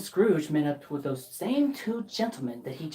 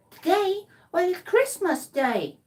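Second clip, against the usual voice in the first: put-on high boy voice; 12 semitones higher than usual. Boy answers Scrooge.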